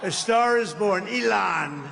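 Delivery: loud rally announcement; announcing shout. Real, not acted. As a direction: proclaim it to the crowd. Rally crowd, announcing new star.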